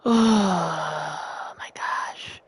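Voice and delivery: very slow, breathy whisper. Real, not acted. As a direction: breathe it out, defeated. After long silence, defeated.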